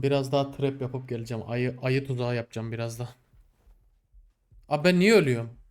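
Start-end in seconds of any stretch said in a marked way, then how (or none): 4.7-5.6 loud, pitched-up protest at dying; exasperated shout. Real, not acted. Gamer yelling at the screen as his character dies, exasperated. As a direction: yell at the game in disbelief.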